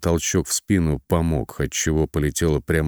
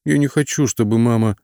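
put-on high whiny spoiled child voice; capricious whine; 7 semitones higher, 4 dB louder than usual. A narrator's reading. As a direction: whine like a pouty little girl. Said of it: "закапризничала дочка".

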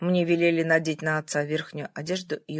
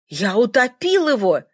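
higher, louder cry of horror; horrified wail; 6 semitones higher, 8 dB louder than usual. A narrator's reading. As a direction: wail out the guilt in terror. Old man in horror confesses. (с ужасом, громко)

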